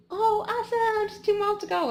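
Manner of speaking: high, loud, chirpy encouragement; mock-bright friend cheering you on; cheery friend. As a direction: bounce it like a perky running buddy. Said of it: "My friend was like".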